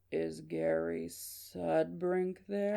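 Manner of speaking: very slow, creepy growl on the phone; slow growly caller. Shown as a demonstration. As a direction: drag every word out in a robotic growl. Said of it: "here's the voice. If I was to impersonate".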